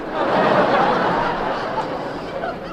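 short breathy laugh; own laugh. Real, not acted. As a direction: laugh along with the crowd. Laughs after punchline setup.

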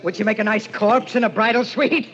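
loud, high, defensive outburst; agitated rant, voice pushed up; unhinged anger. Acted. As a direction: lash out wildly at a prying stranger. Unhinged husband snaps at hotel clerk.

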